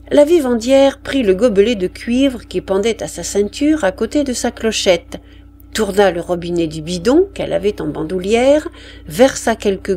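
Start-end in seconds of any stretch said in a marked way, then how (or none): none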